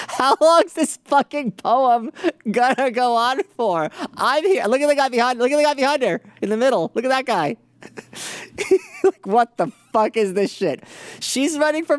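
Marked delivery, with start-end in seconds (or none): 0.1-3.9 higher and slow, voicing her boredom; a pitched-up mocking inner monologue, dragged out. Host voicing a bored woman's thoughts, mocking higher drawl. 4.1-10.8 higher, pointing with mock disbelief; pitched-up incredulous aside; mock inner voice. Host voicing the woman's thoughts, higher mocking incredulity.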